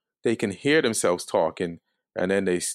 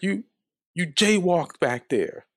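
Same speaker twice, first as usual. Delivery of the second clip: higher-pitched, slowed accusation, mildly imitating a yell; mock yelling; 9 semitones higher, slower than usual. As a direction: act out a yelling cop without real volume. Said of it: "they're yelling".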